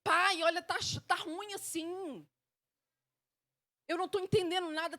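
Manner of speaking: high-pitched honest grumble; complaining prayer. Acted. Child of God telling Father it's bad, high complaint. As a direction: plead your frustration upward, stretching the complaint. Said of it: "falar quando você tá achando ruim com ele".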